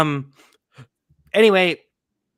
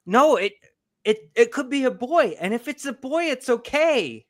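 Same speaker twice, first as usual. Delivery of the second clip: put-on higher, gentle little boy pleading; a small boy reasoning carefully; child voice; 9 semitones higher, 3 dB louder than usual. Father retelling his son's calm reasoning, higher child voice. Son voiced in retold quarrel.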